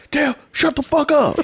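high, loud outburst; exasperated yell. Retold or imitated. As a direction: snap and yell at him to shut up. Acting out dad losing his cool.

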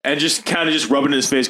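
usual speaking voice

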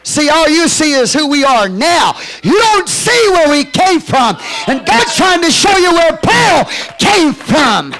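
high, loud, slowed shouted proclamation; full-force preaching. Acted. Pastor shouting his conversion to the congregation, preaching loud. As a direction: shout the testimony in triumph.

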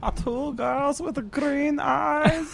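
very slow, high-pitched fake Chinese accent; mock Chinese accent. Guest adds his only other phrase, silly Chinese accent. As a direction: stretch out a badly faked Chinese accent, high. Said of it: "Chinese accent. Can you do that?".

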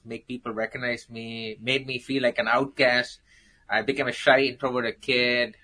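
normal talking voice

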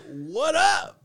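loud, high-pitched answering yell; acted-out exasperated reply; shouting back. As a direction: holler back over the engine. Shouts back to screaming dad.

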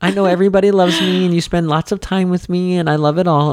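lightly mocking child voice; high daughter voice. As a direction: play the earnest kid, voice up high.

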